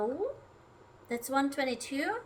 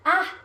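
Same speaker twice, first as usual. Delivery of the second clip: short loud high cry of fright; real startled scream; startled shriek; 5 semitones higher, 10 dB louder than usual. Real, not acted. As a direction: jump and yelp. Startled again by the fly.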